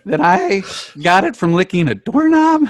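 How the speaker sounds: incredulous, emphatic inventor; high-strung scientist impression; Doc Brown. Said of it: "What if we are four Doc Browns".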